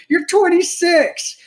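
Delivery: high, drawn-out protest; exasperated, high and slow; incredulous. Real, not acted. Arguing in disbelief at her age.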